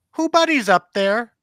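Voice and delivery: put-on high, small child voice asking into the dark; imitating little daughter. Father imitating his small daughter asking who's up there, high childlike voice. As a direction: mimic a toddler calling upstairs.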